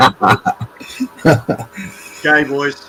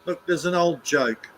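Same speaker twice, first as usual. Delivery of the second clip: ordinary delivery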